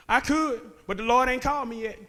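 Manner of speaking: normal talking voice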